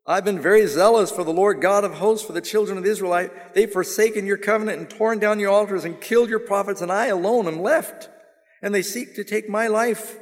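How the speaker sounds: high, loud litany of complaint; rehearsing his woes. Acted. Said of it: "begins to rehearse all of his woe".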